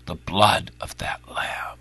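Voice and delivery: hushed, breathy whisper of the line; whispered in awe. Shown as a demonstration. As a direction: drop it to a reverent whisper. Preacher repeats line, then whispers.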